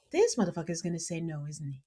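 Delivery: dropping to a hush; quiet dread. Retold or imitated. Bride fearing the groom's answer, hushed. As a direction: say it low under your breath. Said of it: "Daria's just like".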